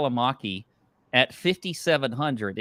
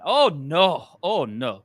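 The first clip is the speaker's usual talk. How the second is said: put-on Scottish caddie accent; 4 semitones higher, 4 dB louder, slower than usual. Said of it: "my Scottish accent comes from".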